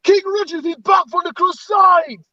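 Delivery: high-pitched proclamation; mock herald. Announcing King Richard back from Crusades, mock herald.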